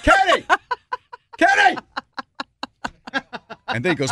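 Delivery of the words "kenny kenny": shouting a name high and loud across a lot; calling out. Retold or imitated. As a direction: yell to get him to look over. Calling brother across parking lot.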